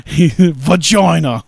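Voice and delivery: loud, drawn-out mock Australian; mock Australian accent. Quoting a comedy's psych-out line, mock Australian accent. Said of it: "Had to speak Australian".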